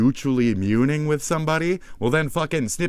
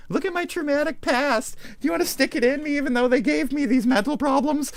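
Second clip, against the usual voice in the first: very high, put-on girly voice; mock victim girl; 3 dB louder than usual. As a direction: mimic a girl fishing for sympathy in falsetto. Voices manipulative girl seeking sympathy.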